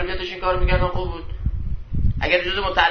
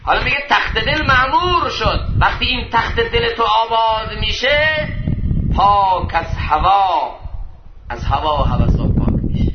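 raised, ringing sermon voice; impassioned preaching; 7 semitones higher, 5 dB louder, slower than usual. Acted. Preacher telling listeners of the purified heart, impassioned. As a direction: preach it out with force, stretching key phrases.

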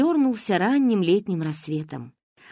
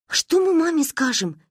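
put-on worried girls; 9 semitones higher than usual. Girls worrying, high voice.

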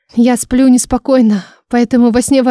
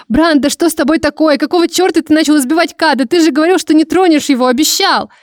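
upset and angry; 6 semitones higher than usual. A narrator's reading. Heroine confronting Brant angrily.

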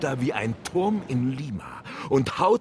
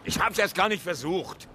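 higher-pitched, indignant protest; indignant retort; 8 semitones higher than usual. Acted. One-armed captain answering Ahab about the whale, indignant. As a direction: protest as if once was plenty.

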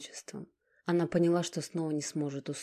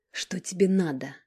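hissing; 3 semitones higher, 7 dB louder than usual. Acted. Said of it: "прошипела Ника".

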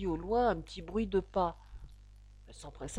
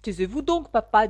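louder, tart retort; sour; 3 semitones higher, 9 dB louder than usual. A narrator's reading. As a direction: snap back sourly at a parent. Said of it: "d'un ton aigre". (réplique d'un ton aigre)

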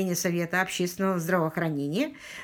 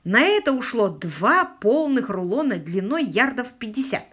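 usual speaking voice

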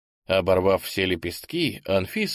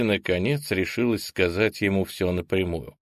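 plain reading voice

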